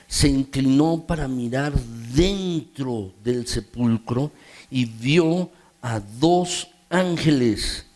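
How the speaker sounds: measured, low reading of the Gospel; scripture reading. Acted. Reads the Gospel passage aloud.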